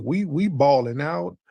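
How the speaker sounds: normal talking voice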